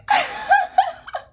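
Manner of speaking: loud high wailing sob, half put on; fake sob burst. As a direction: let out a staged sob. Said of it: "the tear works had to come".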